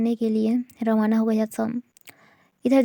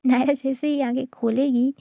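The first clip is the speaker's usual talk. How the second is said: usual speaking voice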